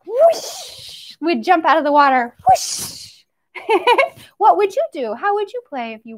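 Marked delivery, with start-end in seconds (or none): 0.0-1.1 high rising whoosh; flying fish whoosh. Pretend. Teacher playing a flying fish for the kids, high whoosh. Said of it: "Let's get out our fish fins". 2.5-3.2 breathy unvoiced whoosh; whispered whoosh. Teacher playing a flying fish again, breathy whoosh. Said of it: "Let's get out our fish fins".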